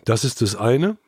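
usual speaking voice

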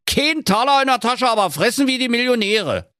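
put-on high, indignant old miser ranting; indignant skinflint rant; scornful miser. A narrator's reading. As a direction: scold the spendthrifts. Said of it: "Da knurrte der Knöterich".

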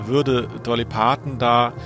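ordinary talk, nothing marked

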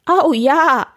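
high, loud whinnying yes; neighing horse. Acted. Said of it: "wieherte sein Pferd". (laut und hoch gewiehert)

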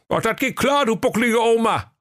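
high-pitched grumbling sleeper-car conductor; comic high fool griping; high sulky conductor. Acted. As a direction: whine about the job in a high silly voice. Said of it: "rief der Schwerthelm".

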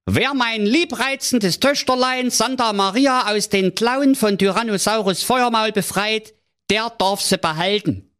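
high pompous king voice. A narrator's reading. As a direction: proclaim it like a fussy king. King's ad voiced.

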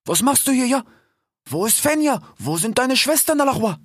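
high, anxious fatherly questions; worried father fox. Acted. Fennec anxiously questioning his son, high voiced. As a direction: fuss over him like a worried dad.

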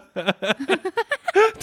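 real burst of high laughter. Real, not acted. Cracking up at the joke, high laughter.

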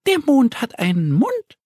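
high little duck voice, excited; Annie calling out. Acted. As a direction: call it out like a small excited duckling. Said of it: "ruft Annie".

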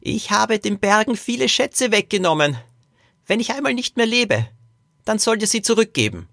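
put-on high-pitched old man confiding; earnest old great-grandfather. Great-grandfather tells the children of his last wish, high earnest voice.

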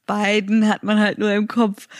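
ordinary talk

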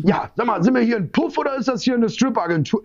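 high-pitched, indignant mock complaint; imitated upset caller. Jealous boyfriend phoning the strip agency, mock upset. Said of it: "Mich der Freund morgens weinend anruft".

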